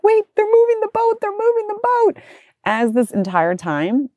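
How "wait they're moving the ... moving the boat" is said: put-on high, alarmed child voice; a little girl panicking as the boat leaves; panicked daughter. Mother quotes daughter seeing boat move away, high panicked voice. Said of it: "Penelope's like".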